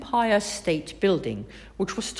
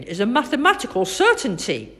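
higher and louder, quick and proud; boy parroting the doctor; 4 semitones higher, 6 dB louder, faster than usual. A narrator's reading. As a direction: echo the doctor's phrase triumphantly. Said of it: "said Finn".

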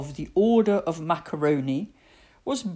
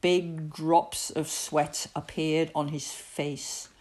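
ordinary delivery

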